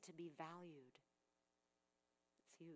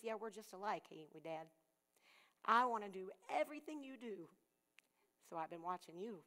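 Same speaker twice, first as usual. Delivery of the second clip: lightly voiced little boy, proud and loud; a hint of an eager small boy; little son's voice; 2 semitones higher, 8 dB louder than usual. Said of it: "And he said".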